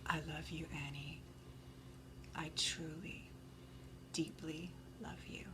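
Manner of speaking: mock breathy whisper. Mocking Padme's love line.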